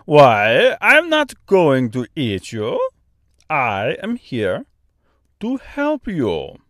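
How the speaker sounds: put-on slow, thick Austrian-French accent; kindly bird in Austrian-French accent. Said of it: "strangely thick, almost Austrian accent".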